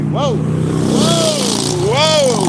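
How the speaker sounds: very high rising whoa of alarm; startled whoa. Real, not acted. Startled whoa at a car passing too close. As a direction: jolt at the sudden car. Near miss while driving.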